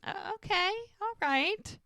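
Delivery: high-pitched imitation of being won over; intrigued concession. Man tasting frosting off her neck, playfully intrigued.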